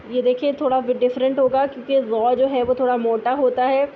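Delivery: ordinary talk, nothing marked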